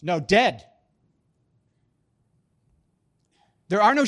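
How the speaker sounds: loud, high, driving preacher climax; preaching at full force. Acted. Preacher pressing the point to the congregation, loud. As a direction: hammer each phrase home.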